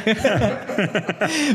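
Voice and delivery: high burst of laughing; real laughter. Real, not acted. Friend laughing at the food joke, amused.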